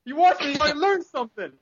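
very high, squeaky put-on boasting; mock cocky gamer. Bad player boasting back at angry teammates, high mock-cocky voice. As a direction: brag in a high cartoonish voice.

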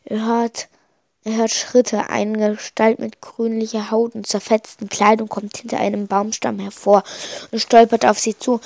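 usual speaking voice

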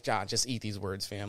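muttered aside. Retold or imitated. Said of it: "I was like".

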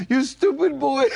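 high, loud mock scold of a grandfather; mock yell. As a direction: playfully yell it like an angry grandpa with a limp. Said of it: "he was going".

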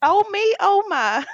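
high Southern belle gasp. Retold or imitated. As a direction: clutch pearls like a Southern belle. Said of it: "sound like a Southern belle".

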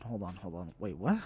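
ordinary talk, nothing marked

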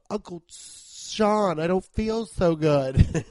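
put-on a kid fading after a nut hits; mock sickly child calling Uncle Sean, slow and laughing. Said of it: "you're just like".

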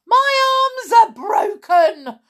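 high, loud exasperated yell; calling back. A narrator's reading. Amber answering the boy, calling back loudly. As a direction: yell back across the distance. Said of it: "she called back".

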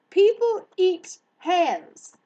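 high, loud, drawn-out horror; appalled. Acted. Hare learning people eat hares, appalled. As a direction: repeat it slowly in outraged disbelief.

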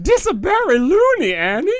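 very high cartoon alien voice; squeaky goofy Gungan; Jar Jar voice. Jar Jar quote in the reading.

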